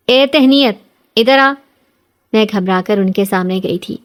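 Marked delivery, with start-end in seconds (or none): 0.1-1.5 loud, higher call across the house; shouting at daughter-in-law. Acted. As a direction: bark her name to summon her. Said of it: "چلاتے ہوئے مجھے آواز دی".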